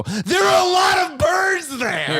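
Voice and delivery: high, loud, drawn-out disbelief; incredulous shout. Retold or imitated. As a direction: holler it slowly, utterly incredulous. Incredulous retort about New York.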